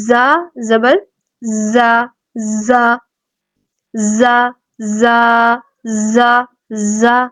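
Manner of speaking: زا said with a buzzing whistle; buzzing zay. Shown as a demonstration. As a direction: let زا buzz. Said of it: "اس میں سیٹی کی آواز آئے گی".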